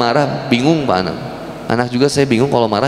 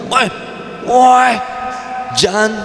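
yelling at a robber; 7 semitones higher, slower than usual. Retold or imitated. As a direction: shout to stop the thief.

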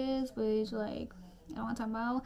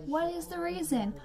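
higher-pitched exasperated question; exasperated demand; 6 semitones higher than usual. Acted. Ranting about parents walking past on Zoom, exasperated demand. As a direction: demand an answer in disbelief. Mock outrage at nosy parents.

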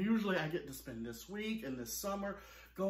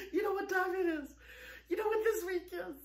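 excited; 10 semitones higher, faster than usual. Real, not acted. Hyping up the big reveal.

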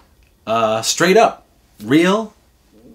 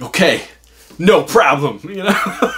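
put-on a bright, higher kid's voice, eager to please; childlike eager yes; 6 semitones higher, 5 dB louder than usual. Boy promising his uncle he'll eat every crumb, eager. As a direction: play the eager little kid.

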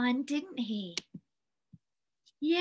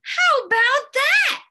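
put-on very high, loud cheer of kids; children chorusing; 12 semitones higher, 9 dB louder than usual. A narrator's reading. Children all admiring the snowman's new coat, high loud chorus. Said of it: "the children all said".

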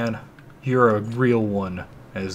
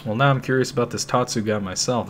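ordinary talk, nothing marked

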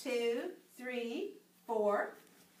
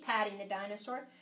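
usual speaking voice

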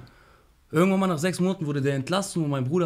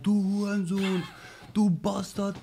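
held-back shout at a raised pitch; imitated yelling; 7 semitones higher, much slower than usual. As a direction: imitate inmates hurling insults from their windows. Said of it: "Jeder schreit".

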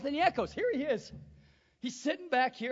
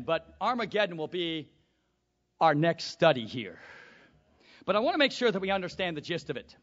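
ordinary talk, nothing marked

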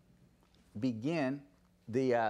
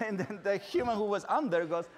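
ordinary talk, nothing marked

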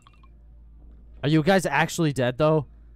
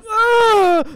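high, loud scream breaking into stutters; panicked scream; 10 dB louder than usual. Real, not acted. Player under attack, panicked screaming. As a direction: scream in fright.

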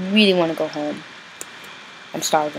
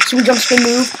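higher and louder hype call; excited move call; 5 semitones higher, 7 dB louder than usual. Real, not acted. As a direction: shout the move name like a combo. Retries the announced move.

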